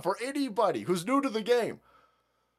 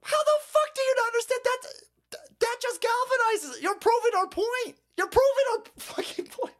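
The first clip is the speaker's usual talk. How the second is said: exasperated yelling. Real, not acted. Ranting at chat commenter.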